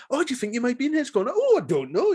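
high, fast coy teasing; mocking Sherry, coy and high. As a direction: tease him, high and quick. Mock flirting dialogue, Sherry coy.

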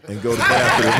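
words with real laughter running through them; laughing. Real, not acted. Recalling the British president's news, laughing. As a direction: laugh it out as you say it.

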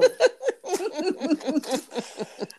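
long, high giggling laugh; burst of laughter. Real, not acted. Host cracks up after listing lesen, laufen, hören, laughing. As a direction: break up laughing, high and sustained.

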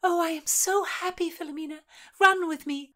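high, loud happy call; joyful. A narrator's reading. Constance asks Philomena to run, high and happy. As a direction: burst with joy.

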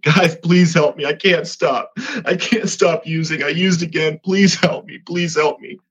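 on his knees begging the group for help; desperate plea. Retold or imitated. Begging the Bible study group.

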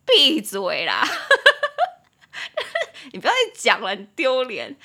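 loud outburst breaking into laughter; joking shut-up. Acted. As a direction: shout it at a teasing friend, laughing. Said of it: "但其实是开玩笑". (大声笑着叫朋友闭嘴)

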